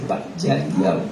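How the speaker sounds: low hushed "vaya, diablo"; muttered under breath. Retold or imitated. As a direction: mutter it to yourself in dread. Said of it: "yo dije así".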